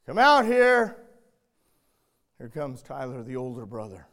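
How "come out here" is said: loud, high summoning call; stern call. Retold or imitated. Dad calling his son out of the bedroom, loud and stern. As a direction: call the boy out, firm and loud. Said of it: "Jeremiah goes".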